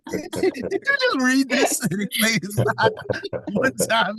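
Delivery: high, slow words through real laughter; laughing. Real, not acted. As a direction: crack up at the comment. Laughing at a chat comment.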